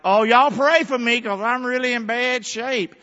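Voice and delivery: hammed-up "poor me" voice; mocking a self-pitying preacher; mock whiny self-pity. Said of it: "I'm not giving this message like".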